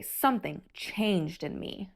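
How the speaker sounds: ordinary delivery, nothing marked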